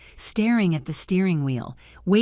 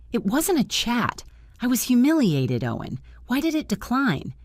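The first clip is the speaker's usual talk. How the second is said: hurt protest; 7 semitones higher than usual. Acted. Wife confronts husband, humiliated.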